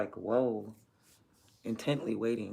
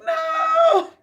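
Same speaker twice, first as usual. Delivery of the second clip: high, loud mock cry of despair; dramatic wail; 10 dB louder, slower than usual. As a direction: wail over a broken plant, hammed up. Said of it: "I really be acting just like that".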